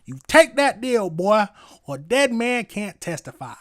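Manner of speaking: high, loud mock Southern accent. Streamer acting out the judge's plea-deal threat, Southern accent. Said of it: "Y'all say it in a Southern accent".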